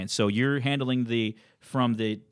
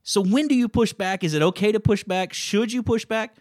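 worked up; 6 semitones higher, 5 dB louder than usual. Real, not acted. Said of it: "You're worked up now".

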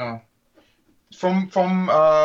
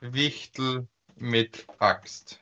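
langsam diktiert; much slower than usual. Acted.

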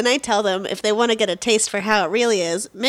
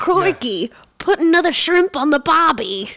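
higher-pitched, hammy Aussie; mock Australian accent; 4 semitones higher than usual. Shown as a demonstration. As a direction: go all out as a pretend Australian. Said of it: "Say like you're from".

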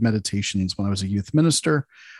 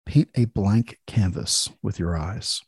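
guided meditation. Acted. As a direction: ease listeners into calm relaxation.

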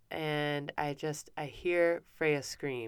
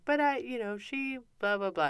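higher mock child's protest, easing down; protesting kids; 8 semitones higher than usual. Other kids arguing their side to mom, mock high-pitched protest. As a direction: imitate kids butting in with excuses. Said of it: "the other kids were like".

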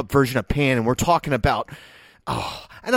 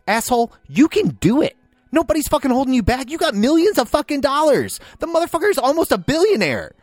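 rising, pitched-up angry rant; a frustrated critic ranting at a rich director; heated rant; 9 semitones higher than usual. Real, not acted. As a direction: builds higher with real irritation. Ranting at Spielberg's rich mentality.

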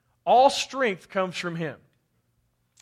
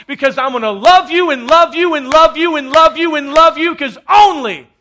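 building from earnest to a louder, higher shout; impassioned vow, growing louder and higher; love's defiant cry; 5 semitones higher, 10 dB louder than usual. Acted. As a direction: swell into a full preaching roar on love you. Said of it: "Love says".

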